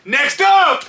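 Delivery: loud, high ringside call; shouted summons across a room. Retold or imitated. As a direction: holler for the next challenger. Calling out the next fighter.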